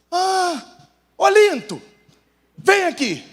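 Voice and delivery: high, indignant calling out; sudden realization. Acted. Grandmother finally understanding, calling grandpa over, high-pitched indignant realization. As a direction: summon the husband in dawning disbelief.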